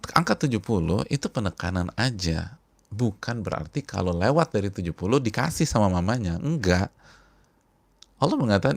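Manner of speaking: loud, emphatic sermon delivery; forceful preaching. Acted. Preacher hammering his point to the congregation, forceful. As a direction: drive each point home to the congregation.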